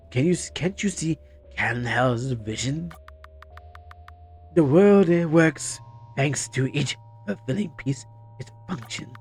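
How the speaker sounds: slow, lofty menacing villain; a cult leader preaching calmly. Acted. Voicing villain dialogue.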